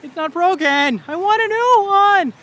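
put-on high, loud, slow childish whine; high, slow, loud tantrum whine; whining. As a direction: whine like a kid wanting a toy. Whining for a new phone.

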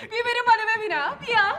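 laughing scornfully, then speeding through the words; mocking laugh. Wife laughing at the fake professor, then dragging her husband upstairs, mocking. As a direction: laugh it off and push ahead.

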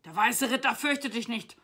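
loud, high defiant declaration; acted bellow of defiance; roaring knight. A narrator's reading. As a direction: proclaim fearlessness at full voice. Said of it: "brüllte der Ritter".

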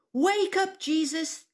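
high-pitched storyteller's yell. A narrator's reading. Frightened friends waking Jesus in the storm, yelling. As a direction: call out urgently to wake someone. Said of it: "they yelled".

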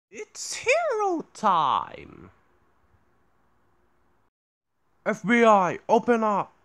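very loud high yell; loud shout. Retold or imitated. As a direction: bang the door and shout. Shouted quotes.

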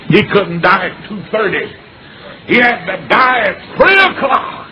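loud, high, slow sermon build; preaching at full force. Acted. A preacher driving home the cross to the congregation, full force. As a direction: preach it from the pulpit, swelling.

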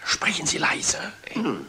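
hushed whisper, no voice at all. Acted. Captain whispering to his sailor to keep it down. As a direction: whisper so nobody overhears.

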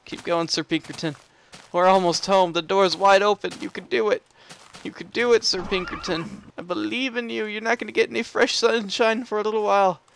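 normal talking voice

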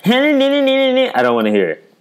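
high nasal-free whine, drawn out slowly; mock the complainers in a high whine; whiny mocking voice.

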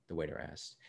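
usual speaking voice

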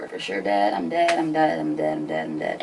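quiet sinking chant; fading echo. Retold or imitated. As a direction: repeat it to yourself, trailing off. Repeating doom to herself.

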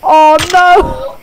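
alarmed shout. Real, not acted. Horrified video playing.

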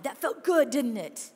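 ordinary talk

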